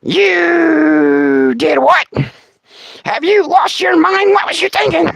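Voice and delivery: high, slow scolding shriek; imitating Martha. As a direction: shriek it like a scandalized grandma. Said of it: "hear Martha go".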